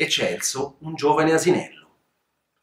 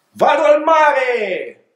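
long, slow, much higher cry of joy; joyful shout; 10 semitones higher, 4 dB louder, much slower than usual. Acted. Old donkey leaping the fence, shouting joyfully. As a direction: yell it out happily, stretching every word. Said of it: "urlò con un sorriso".

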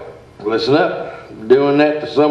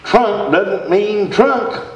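slow explaining; 7 semitones higher, slower than usual. Acted. Explaining words slowly.

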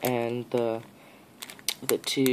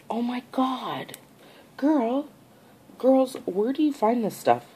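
high-pitched amazement; real, overwhelmed delight; awed, freaking out; 8 semitones higher than usual. Real, not acted. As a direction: gush in high-pitched awe. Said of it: "I'm in awe right now".

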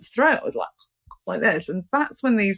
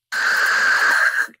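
breathy burst of noise; unvoiced rushing sound imitating energy; imitated loud noise; 4 dB louder than usual. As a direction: huff out the strange noise. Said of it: "like this".

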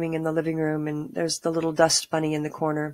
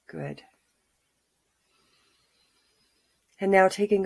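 calm grounding exercise. Acted. Guided grounding meditation.

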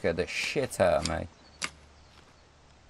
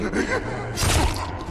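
laughing. Real, not acted. After taunting enemy.

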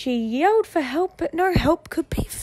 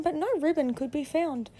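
usual speaking voice

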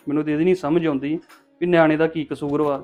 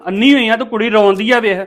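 raised, louder reproach; angry scolding; 5 semitones higher, 5 dB louder than usual. Acted. Man telling mother to see the crying girl, angry.

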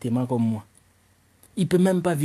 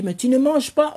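put-on high girl voice; 10 semitones higher, 3 dB louder than usual. Girl asks why he won't eat.

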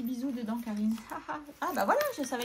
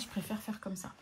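breathy whisper to herself; murmured private aside; 2 semitones lower, 7 dB quieter than usual. Real, not acted. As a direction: drop to a whisper as you write. Aside to herself while writing.

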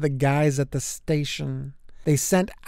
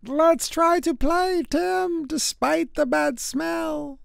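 put-on high child voice, slowing at the end; high, slow friendly call from outside; kids calling; 12 semitones higher, slower than usual. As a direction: pitch it up like a neighbour kid calling over. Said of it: "heard someone yell".

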